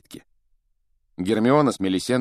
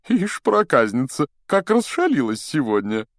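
higher, louder scolding of a naughty pet; playful reproach; 6 semitones higher, 4 dB louder than usual. A narrator's reading. Teacher scolding his dropped wand, playfully reproachful. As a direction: chide it indulgently in a raised voice. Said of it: "укоризненно покачал головой".

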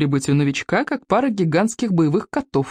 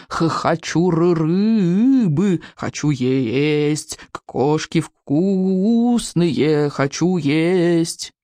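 stuttering, long-drawn hungry wail; slow, stammered mock bawl; bawling dragon; much slower than usual. A narrator's reading. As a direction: stretch the vowels like a whining hatchling. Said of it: "орал".

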